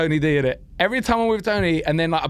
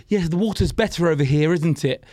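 careful, proper posh English, pitched lower; posh English accent; 3 semitones lower than usual. Shown as a demonstration. Comedian softening his London for foreign crowds, posh English accent. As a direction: put on neat, posh English diction. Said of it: "I'll be like".

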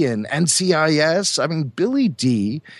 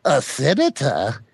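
Zero the Hutt; 3 semitones higher than usual. Retold or imitated. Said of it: "Zero the Hutt is famous for the quote".

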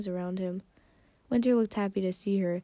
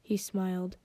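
ordinary delivery

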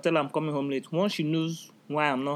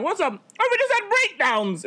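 high, sing-song cheerful, much higher and louder; mock happy; 4 dB louder than usual. Friends greeting each other after breakdowns, mock happy. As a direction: sound delighted about breakdowns. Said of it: "both of us sounded so happy".

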